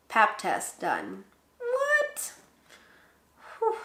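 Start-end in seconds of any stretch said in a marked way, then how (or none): 1.6-3.8 very high-pitched squeal of disbelief; mock shock. Mock shock at first Pap test.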